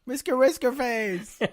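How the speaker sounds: high, slow, silly cry; playful exclamation. Pretend. Co-host echoing the pet ferret's name, silly high exclamation. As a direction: draw out the pet name in a high mock-excited voice.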